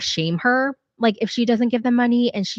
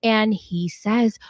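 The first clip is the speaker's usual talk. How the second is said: usual speaking voice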